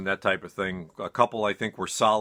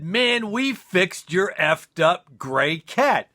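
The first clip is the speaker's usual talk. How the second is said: slow, higher, lightly voiced stoned buddy; stoned roommate; 8 semitones higher, slower than usual. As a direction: drag it out like a proud stoner. Roommate repeats the fix.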